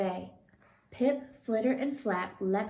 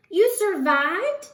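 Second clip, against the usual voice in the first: much higher, louder cry of joy; joyful cry; 10 semitones higher, 9 dB louder, slower than usual. A narrator's reading. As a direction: burst out at the reunion. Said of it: "cried Stellaluna".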